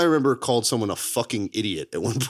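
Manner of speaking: usual speaking voice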